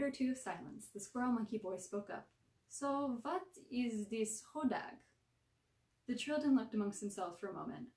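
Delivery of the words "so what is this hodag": put-on slow, thick Russian accent. A narrator's reading. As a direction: ask slowly in a heavy Russian accent. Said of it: "coated with a thick Russian accent".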